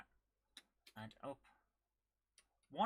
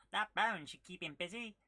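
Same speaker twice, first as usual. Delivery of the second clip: high-pitched, louder cartoon character voice; Dizzy; 5 semitones higher, 6 dB louder than usual. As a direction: put on a high little game-hero voice. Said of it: "Dizzy has that high-pitched voice".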